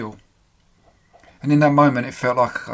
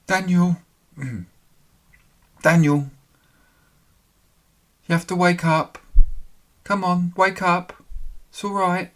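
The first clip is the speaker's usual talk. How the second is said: higher, lightly voiced woman urging him awake; female voice calling; 6 semitones higher, 6 dB louder than usual. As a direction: gently coax Daniel to wake up as a woman. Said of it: "A female voice".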